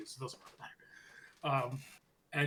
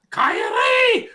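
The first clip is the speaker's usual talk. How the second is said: high, loud mock shout of a name; calling out; 15 dB louder than usual. Asuka yelling after Kairi, a high mock shout. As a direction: yell her name across the room.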